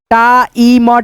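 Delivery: "Time" split as taa-ee-ma; broken into sounds. Shown as a demonstration. Teacher breaks down time for learners, separated sounds. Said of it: "क्या पढ़ेंगे दोस्तों".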